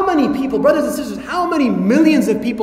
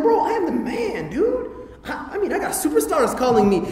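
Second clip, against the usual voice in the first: ecstatic; 6 semitones higher, 4 dB quieter than usual. Retold or imitated. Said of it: "You'll be like ecstatic".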